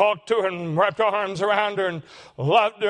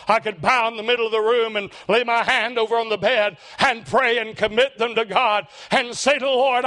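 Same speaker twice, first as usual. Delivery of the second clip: ordinary talk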